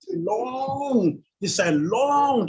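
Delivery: LONG stretched out slowly on a high pitch; drawn-out LONG. Real, not acted. Preacher describing cars lining both sides, LONG drawn out high. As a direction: draw out LONG to show the length.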